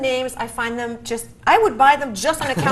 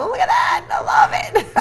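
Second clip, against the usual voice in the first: excited squeal; slower than usual. Real, not acted. Excited over ghost peppers.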